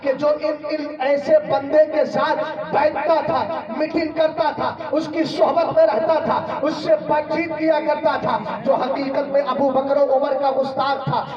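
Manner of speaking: loud, ringing pulpit delivery with long held stresses; full-force preaching. Acted. Preacher booming the tale to the congregation. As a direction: preach it out to the whole gathering.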